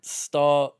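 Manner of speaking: usual speaking voice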